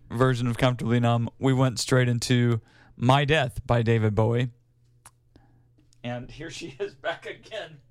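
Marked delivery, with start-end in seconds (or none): 6.0-7.8 quiet aside, barely voiced; hushed aside. Real, not acted. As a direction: mutter it away from the mic. Shooing the cat away mid-show.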